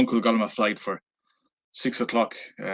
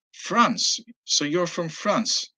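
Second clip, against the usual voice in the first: incredulous; 8 semitones higher, much slower than usual. Retold or imitated. Englishman doubting the claim.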